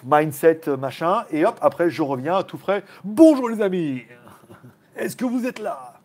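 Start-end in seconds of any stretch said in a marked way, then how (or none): 3.0-5.9 slow, high hammed-up radio host; cheap DJ voice. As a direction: greet the listeners like a cheesy DJ. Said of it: "en mode D J à deux balles". (animateur radio ringard)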